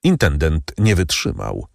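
ordinary delivery

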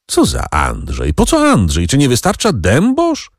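high, exasperated questions; mildly irritated outburst. A narrator's reading. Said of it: "irytował się Edward".

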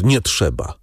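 understated, forced-out NIE TRZEBA; choked out. A narrator's reading. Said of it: "wykrztusił pan de Villefort". (wykrztuszone „nie trzeba”)

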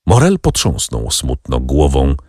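plain reading voice

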